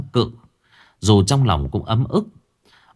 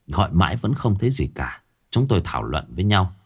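ordinary delivery, nothing marked

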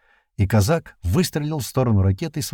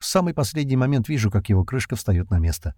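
ordinary delivery, nothing marked

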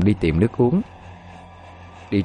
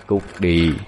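lightly voiced menacing spirit; a measured, haunting command to leave; eerie ghostly voice. A narrator's reading. Spirit driving the wife from its house, understated eerie menace. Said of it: "nhiều tiếng nói cười đầy ma mị".

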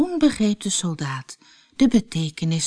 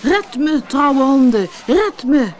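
loud, higher desperate cry; calling out; 6 semitones higher, 6 dB louder than usual. A narrator's reading. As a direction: call the dogs for rescue. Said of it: "riep hij". (luid en hoog roepend)

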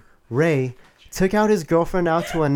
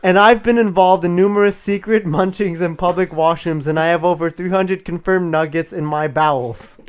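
booming demon lord; 2 semitones higher than usual. Acted. Acted girlfriend's threatening rant.

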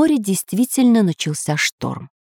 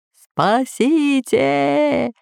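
slow, high drawn-out cry; bawling for help; 7 semitones higher, much slower than usual. Acted. Pirate spun in his nest, slow high bawl. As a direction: stretch out the plea for rescue. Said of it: "ревел Бульбуль".

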